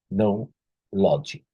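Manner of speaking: "technology" slowly split as tech, no, logy; slow, segmented "technology" to show its syllables. Shown as a demonstration. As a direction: break "technology" into parts with pauses between. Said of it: "Third syllable".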